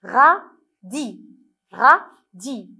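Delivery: RA, pause, DI, each syllable separate. Shown as a demonstration. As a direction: break "Radis" into two distinct beats. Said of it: "Est-ce qu'on entend le son I".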